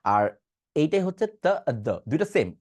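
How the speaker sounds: normal talking voice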